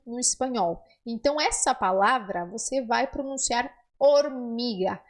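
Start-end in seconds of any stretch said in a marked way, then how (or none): none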